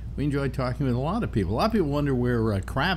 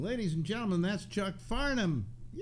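host closing out the interview; host sign-off; 9 semitones higher, 4 dB quieter than usual. Acted. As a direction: wrap up the show for the listeners.

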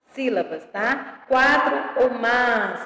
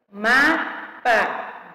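"Mapa" in two separated beats, ma-pa; syllable by syllable. Shown as a demonstration. Teacher giving a two-syllable example, syllable by syllable. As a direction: split "Mapa" with a gap between syllables. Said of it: "Duas sílabas".

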